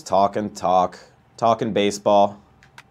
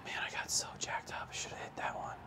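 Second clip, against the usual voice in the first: whispered frustration to himself; 21 dB quieter than usual. Real, not acted. Player whispering to himself after missing the pitch. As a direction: hush it, annoyed at the miss.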